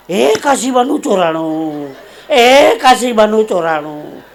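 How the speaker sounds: loud, slow, stretched cry; drawn-out alarm shout. Acted. Repeating the night cry that Kashiba's been robbed, drawn-out shout. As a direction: holler it with long vowels. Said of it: "ઓચિંતાની બૂમ પાડી".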